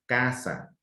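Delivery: "casa" stressed on the first syllable, CA-sa. Shown as a demonstration. Teacher showing where the strongest syllable is, stress on CA. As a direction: lean on CA in "casa", drop the ending. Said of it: "la sílaba de mayor intensidad está en K".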